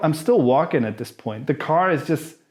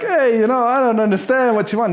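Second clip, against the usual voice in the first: high, loud naive voice; playing dumb tourist; 8 semitones higher, 4 dB louder than usual. Comic feigning confusion at the demand, high and loud. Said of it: "So I go like".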